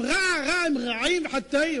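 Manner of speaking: high, loud, drawn-out crow cries; crying out. Acted. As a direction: call it out like screeching crows. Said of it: "הם צועקים".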